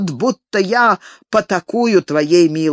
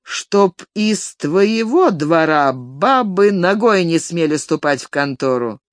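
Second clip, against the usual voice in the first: ordinary delivery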